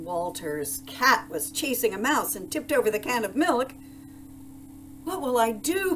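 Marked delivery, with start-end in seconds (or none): 5.0-5.9 higher-pitched wail of dismay; distressed cry. A narrator's reading. Baker whose milk has spilled, a dismayed cry. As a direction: cry out in alarm, pitch raised. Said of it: "cried Walter".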